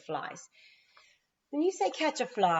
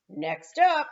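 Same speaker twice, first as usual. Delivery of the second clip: coach calling; 10 semitones higher, 8 dB louder than usual. A narrator's reading. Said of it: "said Max's mom".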